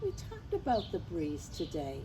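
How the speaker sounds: ordinary delivery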